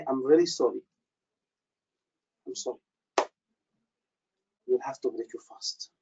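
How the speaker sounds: imitating a doctor's low, soft apology; apologetic doctor. Doctor to fasting patient who collapsed, hushed apology. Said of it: "he said it like this".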